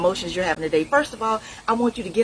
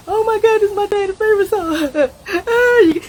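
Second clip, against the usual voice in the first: loud, high, hammed-up sob through the words; mock crying; 11 semitones higher, 6 dB louder than usual. Preacher acting out a griever hearing dad's song, loud mock sob. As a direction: act out breaking down at the wheel.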